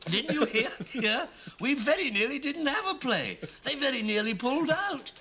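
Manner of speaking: high, mock-frantic voice breaking into laughter; laughing imitation. Playwright quoting the director on the play nearly pulled, high and laughing. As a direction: imitate a panicked caller while cracking up.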